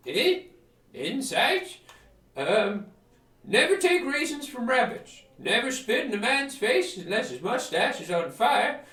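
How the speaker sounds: put-on high, loud doddering old hermit; daft hermit. Hermit answering the adventurer, high loud batty. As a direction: rattle off silly wisdom like a batty sage. Hermit gives nonsense advice.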